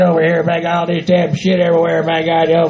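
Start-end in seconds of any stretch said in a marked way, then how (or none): none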